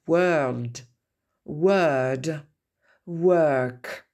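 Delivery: "World", "Word", "Work" each with a drawn "er"; long central vowel. Shown as a demonstration. Teacher repeating the model, "World", "Word", "Work" with long "er". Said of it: "Je les répète".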